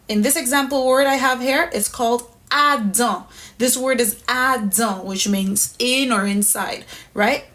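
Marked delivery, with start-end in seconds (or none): none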